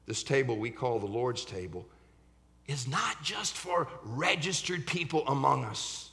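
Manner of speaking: ordinary talk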